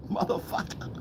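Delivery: voiceless, hushed swear; soft whispered exclamation; whispered curse. Real, not acted. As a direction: whisper it under the laugh. Reacts to the exposed trick.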